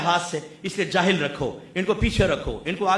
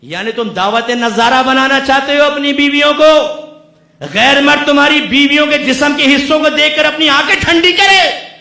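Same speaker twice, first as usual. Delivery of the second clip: fiery preaching; 8 semitones higher, 13 dB louder than usual. Acted. Preacher scolds husbands for showing off wives, furious shout. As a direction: thunder at the husbands, building to a shout.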